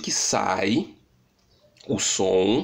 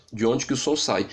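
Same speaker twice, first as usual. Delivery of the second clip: normal talking voice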